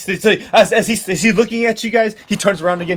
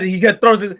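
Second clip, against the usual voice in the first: ordinary talk, nothing marked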